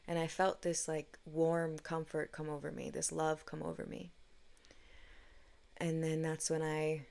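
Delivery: ordinary talk, nothing marked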